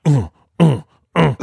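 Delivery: short mock grunts, spaced apart; imitated sex grunts. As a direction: imitate muffled grunts from upstairs. Imitating noises from upstairs.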